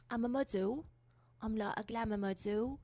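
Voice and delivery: a hint of an Essex accent, drawn out at the end; lightly voiced Essex glamour model. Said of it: "talk like this".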